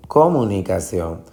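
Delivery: comunicación stressed as CO-municación; stress on first syllable. Shown as a demonstration. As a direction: lean hard on co at the start of comunicación. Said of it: "si usted se va a buscar". (comunicación acentuada en la primera sílaba, CO)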